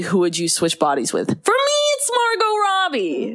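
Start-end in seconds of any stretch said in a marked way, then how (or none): 1.4-3.4 very high-pitched gleeful outburst; giddy high squeal; excited squeal. Real, not acted. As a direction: squeal it out, bursting into laughter. Gushes over favorite celebrity pick.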